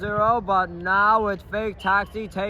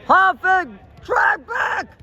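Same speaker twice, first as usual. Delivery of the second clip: calling out; 8 semitones higher, 3 dB louder, slower than usual. Real, not acted. Calling players to defend.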